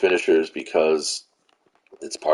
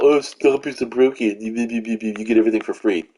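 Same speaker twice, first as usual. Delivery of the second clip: higher-pitched whiny complaint, imitating moaners; put-on grumbling, pitched up; mock moaning; 5 semitones higher than usual. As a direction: mimic people griping, rattling off the end. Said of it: "people pissing and moaning about".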